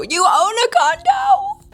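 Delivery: high, slow, drawn-out gasp of wonder; hammed-up impressed squeal; mock amazement. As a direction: act dazzled by the condo. Mock amazed reaction to reader.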